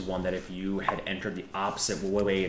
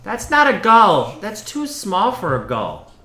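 much higher, louder indignant nun; sharp loud correction; 12 semitones higher, 15 dB louder than usual. Acted. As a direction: snap back, correcting her loudly.

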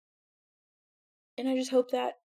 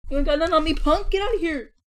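high, loud trash talk to the dog; loud playful taunt; 8 semitones higher, 11 dB louder than usual. Real, not acted. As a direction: yell it at the dog, teasing.

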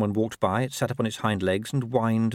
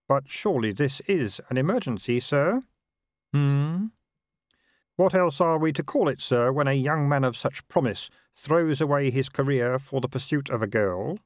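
higher, earnest clerk voice; concerned clerk; 5 semitones higher than usual. A narrator's reading. Clerk pressing the Patrician about an emergency, earnest and deferential. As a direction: press your superior politely but urgently.

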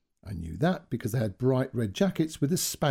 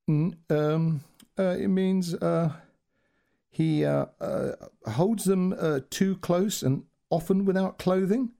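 halting, stammering, full of ums; struggling, hesitant; 7 semitones higher, slower than usual. Retold or imitated. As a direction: grope for each word, slowed down. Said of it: "now he really was struggling".

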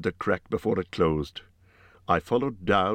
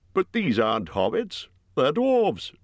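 gruff Bjorn; 8 semitones higher than usual. A narrator's reading. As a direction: voice Bjorn as a blunt giant host. Bjorn voiced by narrator.

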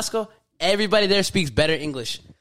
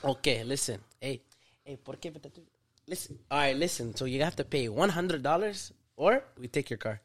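put-on Mexican cop accent; 3 semitones lower, 8 dB quieter than usual. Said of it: "you be the cop".

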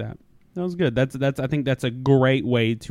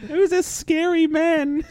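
put-on slow, very high kid voice; scared child; slower than usual. Kids asking about the stranger at home, high mock-scared whine. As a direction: draw it out in a mock-frightened child's voice. Voicing boss's frightened children.

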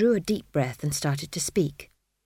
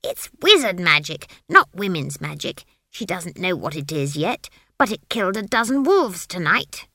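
agitated witch; 5 dB louder than usual. A narrator's reading. Witch argues with tree.

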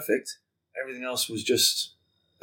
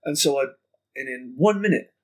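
usual speaking voice

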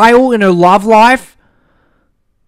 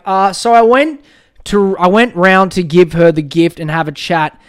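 ordinary talk, nothing marked

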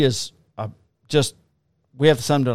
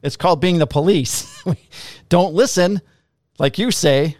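retorting louder; 5 semitones higher, 5 dB louder than usual. Retold or imitated. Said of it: "I'm like".

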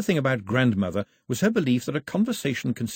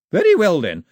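higher, louder scornful matriarch; scornful grandmother; 8 semitones higher, 5 dB louder than usual. Acted. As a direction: turn his words back at him. Grandmother mocks his list.

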